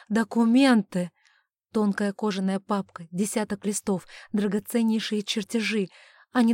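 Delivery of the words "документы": loud, drawn-out exclamation; sudden insistent cry; urgent realization. A narrator's reading. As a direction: stretch the word out loudly with urgency. Same word, now urgent cry.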